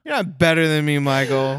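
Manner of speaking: a playful telling-off to a friend; mock yell. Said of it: "Sorry I yelled at you".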